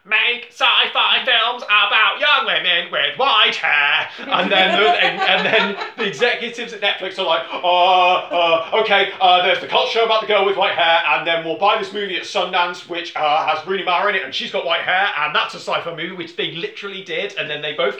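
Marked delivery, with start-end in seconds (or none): 0.0-4.1 slow, loud, higher, mock-robotic command; mock machine voice. The machine dictates the film plan in a mock robotic voice. 7.5-16.1 mock dithering executive. Netflix executives pitch a lazy movie, hammed-up dithering.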